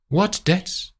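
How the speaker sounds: high, mock innocent; high, faked surprise; feigned surprise. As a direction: pretend not to know. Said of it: "Luke acts surprised".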